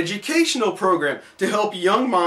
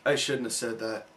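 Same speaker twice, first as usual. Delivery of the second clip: quiet regret; 10 semitones lower, 10 dB quieter than usual. Real, not acted. Regret after tempting fate.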